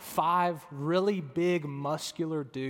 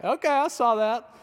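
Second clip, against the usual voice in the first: loud, higher-pitched mock callout; teasing roast; 7 semitones higher, 7 dB louder than usual. Husband roasts wife over her awkward first try, teasing loudly. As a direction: rib them with a raised voice. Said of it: "like, roasting her for it".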